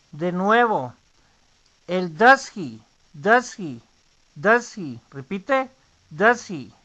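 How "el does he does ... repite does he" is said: "does he" said as "dasi", h almost silent; reduced "does he". Shown as a demonstration. Teacher drilling the reduced does he. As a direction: drop the h: "dasi". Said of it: "Apenas se pronuncia".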